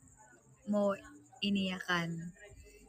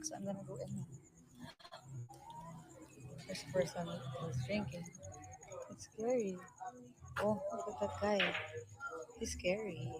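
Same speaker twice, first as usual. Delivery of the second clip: nervous hush; 9 dB quieter than usual. Real, not acted. Livestreaming near a drunk man outside, speaking in a wary hush. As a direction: keep it down so he won't hear.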